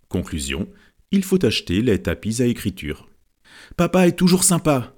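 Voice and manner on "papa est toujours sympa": higher, bright happy exclamation; joyful cry. A narrator's reading. Little girl thanking her dad, joyful cry. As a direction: cry it out full of joy. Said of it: "s'écria Dadine pleine de joie".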